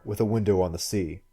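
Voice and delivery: ordinary delivery, nothing marked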